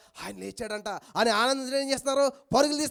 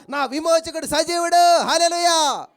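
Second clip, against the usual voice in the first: higher, louder triumphant cry; Job's declaration; 6 semitones higher, 8 dB louder, slower than usual. Acted. Job declaring his redeemer lives, high and loud. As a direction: proclaim it in triumph. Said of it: "నా యోబు ఏమంటున్నాడు తెలుసా".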